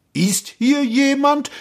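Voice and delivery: slow, high searching call; repeated call. A narrator's reading. Prince asking the gorge a second time, slow high call. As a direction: ask it out slowly into emptiness. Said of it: "fragte er noch einmal".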